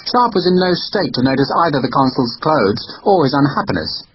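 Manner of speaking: usual speaking voice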